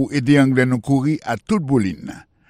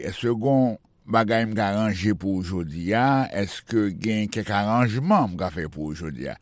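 ordinary talk

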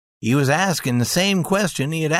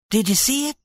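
eager; 6 semitones higher, faster than usual. A narrator's reading. Wife eager question.

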